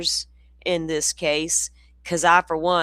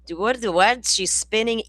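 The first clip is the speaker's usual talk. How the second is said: ordinary talk, nothing marked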